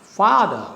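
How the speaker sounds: father starting high on FA and going down; falling rhythm. Shown as a demonstration. Teacher demonstrates going down on a trochee, father falling from FA. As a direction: stress fa in father, then fall away. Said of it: "So you go down".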